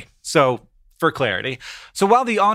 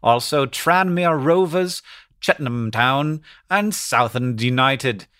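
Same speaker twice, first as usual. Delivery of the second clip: put-on slow British newsreader accent; measured mock-BBC English accent; slower than usual. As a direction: read it out slowly in BBC English. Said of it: "this is like B B C voice".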